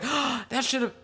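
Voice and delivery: high, breath-caught mock surprise; gasp, then act out a sudden realization. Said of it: "You were just like".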